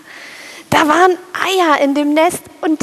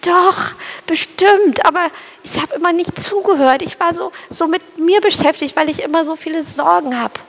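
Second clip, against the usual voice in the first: higher, rushed worrying bird voice; anxious little sparrow; 4 semitones higher than usual. Acted. Fearful sparrow listing dangers to his new mate, high rushed fretting. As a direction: tumble out every fear fast.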